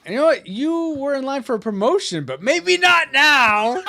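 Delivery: high, loud captain's scolding; mock outrage. Mock threat to withhold promotion.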